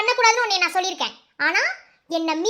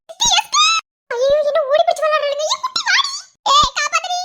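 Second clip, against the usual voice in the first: shouting for help; 6 semitones higher, 4 dB louder than usual. Acted. Daughter runs, calls for help.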